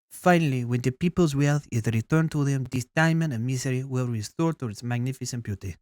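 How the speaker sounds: put-on wobbly French-Russian accent; French accent, Russian creeping in. Queen foretelling the diamond's return, Russian-tinged French accent. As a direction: read solemn lines in a slipping French accent. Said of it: "Russian is sneaking its way into".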